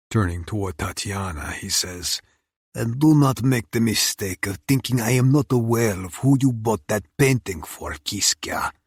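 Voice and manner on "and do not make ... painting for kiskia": a hint of a Russian mob boss; menacing Russian boss. Oleg threatens Tatiana.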